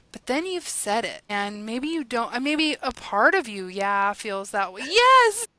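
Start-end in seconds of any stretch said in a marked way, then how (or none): 4.8-5.5 a real burst of eager agreement, much higher and louder; excited yes. Real, not acted. Guest eagerly agrees as host names her point, excitedly. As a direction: shout yes with full delight.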